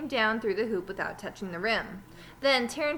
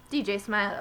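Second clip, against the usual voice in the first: ordinary delivery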